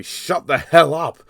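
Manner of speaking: slow, loud mock snap at the movie; exasperated slow yell; shut-up yell. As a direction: bark it out, fed up with the noise. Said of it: "and say to this movie".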